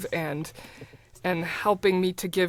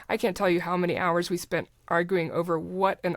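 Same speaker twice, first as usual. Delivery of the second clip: usual speaking voice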